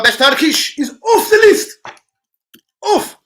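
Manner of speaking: high, loud, slow proclamation; mock announcement. Real, not acted. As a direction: declare it off the list with flourish. Emphatic announcement to chat.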